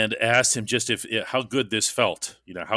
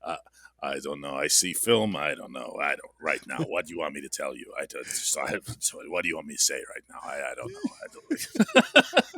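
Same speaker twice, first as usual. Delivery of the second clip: put-on low, lightly done Nigerian accent; 7 semitones lower, 5 dB quieter than usual. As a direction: imitate a teammate's Nigerian accent. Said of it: "my best Chooks Nigerian accent".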